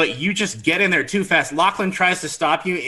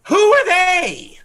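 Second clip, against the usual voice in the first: very high, slow indignant cry; exasperated lady; 7 dB louder, slower than usual. Acted. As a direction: throw the question at the sky. Lady throws trumpet down.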